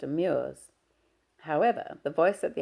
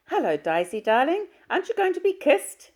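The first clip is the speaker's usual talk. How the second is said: gushing, sing-song mother, pitched far higher; mother's voice; 13 semitones higher, 8 dB louder than usual. Acted. Mother fusses over her shy daughter, high and gushing.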